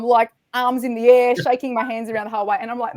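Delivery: normal talking voice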